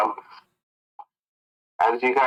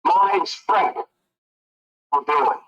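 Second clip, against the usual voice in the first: cheery high voice; 4 semitones higher than usual. Acted. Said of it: "extends a hand and says".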